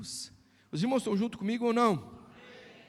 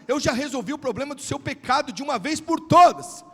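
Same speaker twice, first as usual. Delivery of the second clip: loud, driving sermon voice; full-force preaching; 5 semitones higher, 11 dB louder than usual. Acted. Pastor preaching to the church at full force. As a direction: preach it hard to the congregation.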